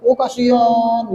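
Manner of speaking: drawn-out, high-pitched pitying coo; mock pity. Preacher imagines God pitying the pretender, mock pity. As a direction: stretch it out in mock sympathy.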